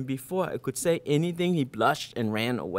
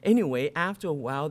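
ordinary delivery

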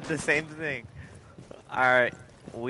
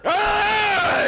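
high loud sudden shout; 6 dB louder than usual. Real, not acted. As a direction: yell it out.

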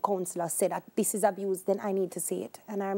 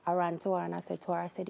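usual speaking voice

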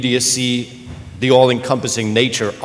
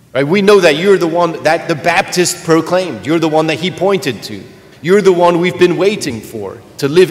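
higher, louder eager acclaim; eager disciples; 4 semitones higher, 3 dB louder than usual. Retold or imitated. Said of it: "what they're saying is".

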